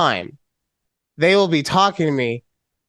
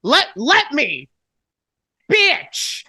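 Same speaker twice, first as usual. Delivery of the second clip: mock exasperated yelling; 8 semitones higher than usual. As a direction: shout it as joking outrage. Mock outrage, cut off compliment.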